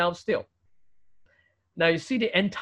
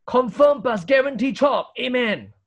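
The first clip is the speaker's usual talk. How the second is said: raised, punchy Singlish affirmation; emphatic Singlish; 5 semitones higher, 4 dB louder than usual. Shown as a demonstration. Preacher translating Amen into Singlish, emphatically certain. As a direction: declare it as an absolute guarantee, higher pitched. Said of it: "if you like Singlish".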